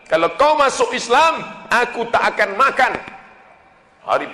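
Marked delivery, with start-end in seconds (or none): 0.0-2.9 high loud threat; a mother threatening her convert son. Retold or imitated. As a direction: warn him sharply, high and loud. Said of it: "mengancam sahabat Nabi".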